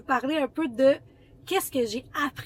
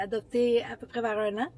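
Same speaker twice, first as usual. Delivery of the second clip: ordinary talk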